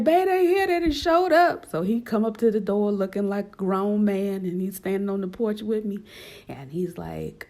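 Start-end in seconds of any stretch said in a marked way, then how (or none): 0.0-1.7 high, loud urgent call; urgent alarm. Retold or imitated. Wife calling husband as agents arrive, high loud alarm. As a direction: call out in a panic.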